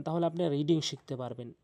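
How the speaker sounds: ordinary delivery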